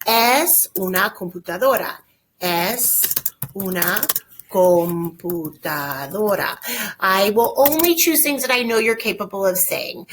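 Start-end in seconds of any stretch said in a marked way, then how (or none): 2.4-6.6 word by word, with pauses between; slow dictation. Shown as a demonstration. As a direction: spell it out slowly for learners, stretching "computadora". Teacher repeats it slowly for students.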